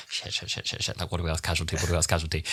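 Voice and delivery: hushed, rapid panicked huddle; quick, quiet, flustered scramble; mock panic. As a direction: whisper the swearing, then rush the question. Retold panicked huddle before interview.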